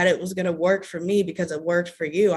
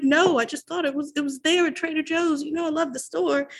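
flustered explanation; 8 semitones higher than usual. Retold or imitated. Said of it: "I was like".

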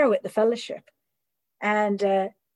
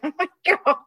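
embarrassed gasp; 9 semitones higher than usual. Retold or imitated. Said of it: "And I was like".

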